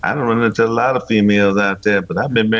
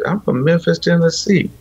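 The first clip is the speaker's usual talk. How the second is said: Southern drawl; 6 semitones higher than usual. Retold or imitated. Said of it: "that slow kind of draw Southern accent".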